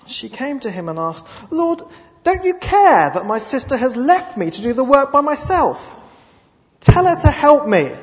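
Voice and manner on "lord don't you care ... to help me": loud, high, aggrieved plea; Martha's complaint. Acted. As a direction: hurl the complaint at the Lord.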